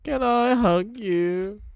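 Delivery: very high, slow pleading ask; mock pleading voice. Imitating [name] begging [name] for a hug, very high. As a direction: stretch it out like a begging child. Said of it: "'cause he's like".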